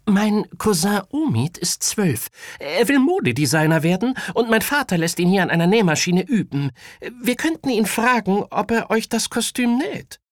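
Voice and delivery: put-on high, lively child character; eager boy with idea. A narrator's reading. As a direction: pitch your plan to friends as a kid. Voices Kaya pitching the idea. (aufgeweckter Junge mit hoher Stimme)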